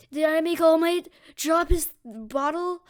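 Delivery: loud, high, slow dawning horror; alarmed realization. Acted. Deku realizes the escape is his doing, alarmed. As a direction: realize it aloud, stretching the words.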